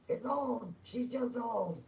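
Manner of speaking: very high-pitched mock cry; high mock outburst. Insisting that something is just old, in a high mock cry.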